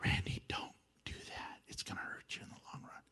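soft unvoiced whisper; whispered warning. God's voice nudging Randy, a soft whisper. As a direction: whisper it like a still small voice. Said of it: "God sends a".

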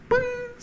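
sharp squeaky high boing; spring up with a cartoon boing; comic boing. Preacher mocking lost rewards with a cartoon boing.

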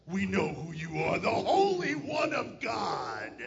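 possessed man howling at Jesus in the synagogue; demonic voice. Shown as a demonstration. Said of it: "For me to just read this to you, I don't think you really picture".

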